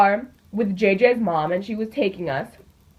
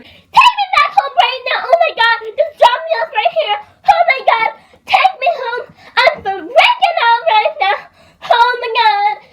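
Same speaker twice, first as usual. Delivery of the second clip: much higher, louder frantic pleading; panicked freakout; 14 semitones higher, 5 dB louder than usual. Retold or imitated. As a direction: shriek like she's begging to go home. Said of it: "she's freaking out".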